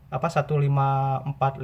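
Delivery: ordinary talk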